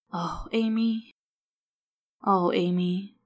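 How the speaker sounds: mild sorrow for a grieving friend; understated sad comfort; sadly. A narrator's reading. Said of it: "Scully said sadly".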